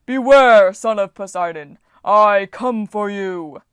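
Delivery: loud, slow booming threat; giant bellow. A narrator's reading. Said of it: "a deep voice bellowed".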